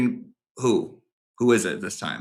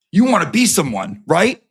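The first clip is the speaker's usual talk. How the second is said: louder jeering challenge; mocking taunt; 4 semitones higher, 6 dB louder than usual. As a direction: push the taunt hard in his face. Mocking confrontation of Jimmy.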